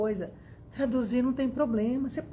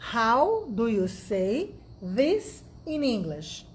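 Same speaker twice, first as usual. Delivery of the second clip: "How do you say this in English?" stretched, each word clear; slow it down for learners to copy; slow and careful; much slower than usual. Shown as a demonstration. Teacher repeats the question slowly for students.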